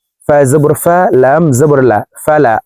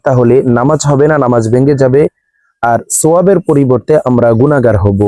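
usual speaking voice

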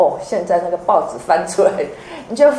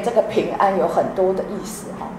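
usual speaking voice

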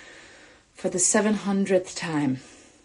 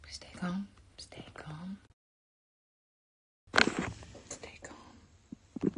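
hushed self-talk, barely voiced; tense whisper under the breath; nervous whispering; 6 semitones lower, 15 dB quieter than usual. Real, not acted. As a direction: whisper it to yourself to steady the nerves. Nervous self-talk before calling.

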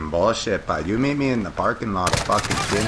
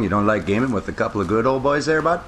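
lightly put-on Canadian hoser talk, "eh, boy"; a beer-loving small-town Canadian, easygoing; Canadian hoser accent; 2 semitones higher than usual. Hoser banter while gaming.

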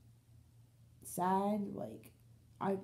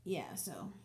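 whisper; 2 semitones lower, 8 dB quieter than usual. Real, not acted. Trailing aside.

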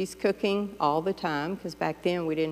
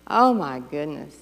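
usual speaking voice

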